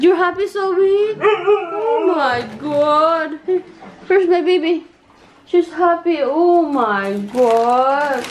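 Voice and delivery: high, slow gushing. Real, not acted. Owner gushing at her happy dog in high slow baby talk.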